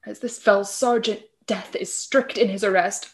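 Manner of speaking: usual speaking voice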